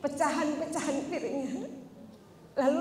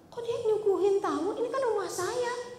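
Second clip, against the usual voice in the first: high, indignant mutter of a jealous wife; a lightly voiced jealous wife; jealous young wife; 6 semitones higher than usual. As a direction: sulk it from behind the door, high. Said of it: "Aisyah ngintip dari balik pintu".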